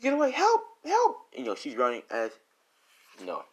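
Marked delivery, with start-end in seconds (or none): 0.0-0.3 high, panicked denial; rising fear, pitched up high; girl realising Rose is serious, high and panicked. Acted. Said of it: "this girl's like". 0.3-1.2 very high, loud screams for help; girl fleeing Rose, screaming very high and loud. Acted. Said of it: "this girl's like".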